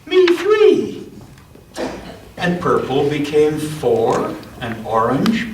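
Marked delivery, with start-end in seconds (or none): 0.1-1.1 much higher, eager chime; high eager me three. Acted. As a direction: pipe up with joy. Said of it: "Green agreed and said".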